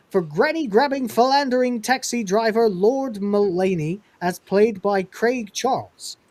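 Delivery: mocking Northern accent. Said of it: "taking the piss out of the accent".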